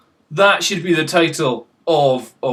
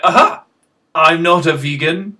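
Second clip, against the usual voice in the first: triumphant aha; 5 dB louder than usual. Retold or imitated. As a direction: crow over a discovery with a laugh. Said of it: "So I'm like".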